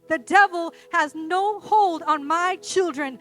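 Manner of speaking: loud, emphatic declarations of authority; rousing, commanding sermon delivery; full-force preaching. Real, not acted. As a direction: drive every phrase home like a fired-up preacher.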